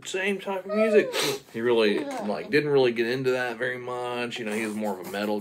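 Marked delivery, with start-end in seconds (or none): 0.0-1.1 excited gush. Retold or imitated. Boy voiced excitedly in story.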